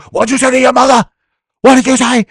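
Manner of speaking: high, loud mock-angry father; hammed-up dad roaring at his son; father yelling at the son for cursing his mother; furious father.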